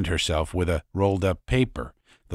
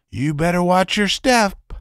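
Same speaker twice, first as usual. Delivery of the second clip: usual speaking voice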